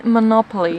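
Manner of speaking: MONOPOLY as "muh-NOP-uh-lee"; Monopoly with weak first syllable, imitating a correction; imitated correction. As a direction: mimic the English Monopoly, stress on "nop". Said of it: "he was like".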